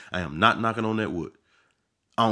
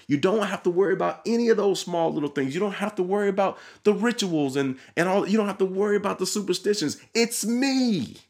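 preaching at full force; 8 semitones higher than usual. Acted. Preacher urging listeners to come to Jesus, fervent higher call. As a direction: lift the voice and plead like a preacher.

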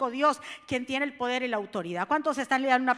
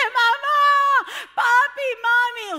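put-on high child's call; lightly voiced child calling for mom; 10 semitones higher, 10 dB louder, much slower than usual. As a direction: call out like a scared kid.

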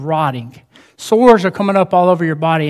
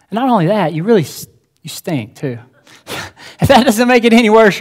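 ordinary delivery

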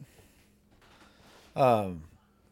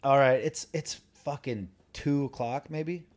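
ordinary delivery

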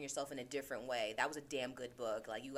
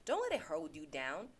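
ordinary talk, nothing marked

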